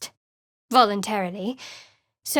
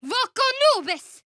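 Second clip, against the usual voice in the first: much higher, ringing cry of a spell; spell incantation; 13 semitones higher than usual. Acted. Sorcerer casting in battle, ringing incantation. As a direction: call the spell out like a war chant.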